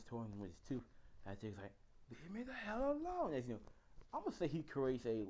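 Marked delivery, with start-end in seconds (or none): 2.1-3.3 high, strained cry of frustration; strained yell. Retold or imitated. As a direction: push it up high and desperate. Deku fighting the Tooth guy.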